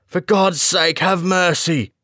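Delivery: high, loud, pleading voice unlike the narrator's; a terrified man's high plea; desperate plea. A narrator's reading. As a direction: beg for mercy from behind the door. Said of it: "said the voice".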